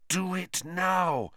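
a slow hushed inner urging; whispery telepathic voice. Acted. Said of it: "said a voice in his head".

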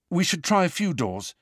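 high-pitched, mildly hurried suggestion; hasty. A narrator's reading. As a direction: say it a touch hastily. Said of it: "he said hastily".

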